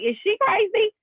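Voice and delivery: high incredulous babies; high, mildly mocking reaction; mock baby voice. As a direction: voice the twins' disbelief, high.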